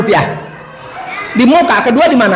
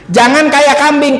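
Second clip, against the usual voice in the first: ordinary talk, nothing marked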